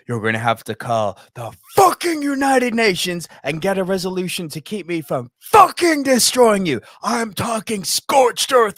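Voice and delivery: loud, slowing, shouted threats; hammed-up furious movie-villain rant; Les Grossman rant. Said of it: "this quote from Les Grossman".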